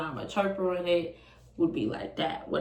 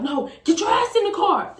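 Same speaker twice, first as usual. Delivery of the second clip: high, loud mock command; angry ordering; 10 semitones higher, 7 dB louder than usual. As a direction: re-enact his yelled order. Boyfriend orders her again.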